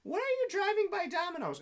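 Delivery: whiny drunk impression, leaping high at the end; drunk ex-wife. Drunk wife wanting Domino's from the back seat, high whine. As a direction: imitate a drunk's demanding whine. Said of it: "this is how she talks".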